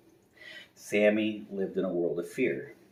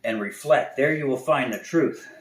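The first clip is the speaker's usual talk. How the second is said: louder, higher solemn owl voice; wise owl; 7 semitones higher, 10 dB louder than usual. Acted. Wise owl advising the frightened bear, solemn and louder. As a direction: pronounce it like a sage. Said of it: "he says".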